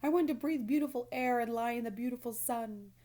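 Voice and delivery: weeping piglet lament; tearful wailing. A narrator's reading. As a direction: sob out every wish. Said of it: "Wilbur burst into tears".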